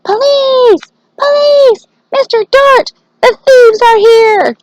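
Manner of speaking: high, loud, frantic shout for the police; a boy yelling for the police to scare off thieves; terrifying shout. Acted. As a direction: scream for help at the top of your lungs. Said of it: "he shouted in his loudest, most terrifying, terrifying voice".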